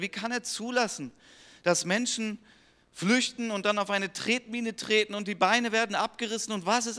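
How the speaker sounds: preaching at full force. Acted. Preacher urging the congregation to find Jesus, fervent preaching. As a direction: proclaim it to the whole congregation.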